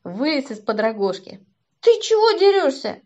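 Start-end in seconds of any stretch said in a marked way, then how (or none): 1.8-3.0 громкий высокий окрик; крикни обидчику с возмущением; возмущённый крик. A narrator's reading. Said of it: "И я как заору". (возмущённо заорать)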